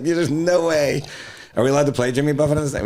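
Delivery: high, stretched-out incredulous voice; mock disbelief. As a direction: act shocked in a hammed-up way. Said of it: "I'm like".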